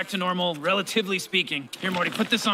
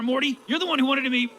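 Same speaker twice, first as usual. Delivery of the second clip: ordinary talk, nothing marked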